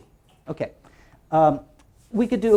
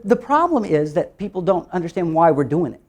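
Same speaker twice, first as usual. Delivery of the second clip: ordinary talk, nothing marked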